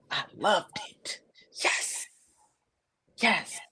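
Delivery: soft unvoiced whisper; quiet hushed whisper. Real, not acted. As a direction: whisper it with delight. Whispered approval.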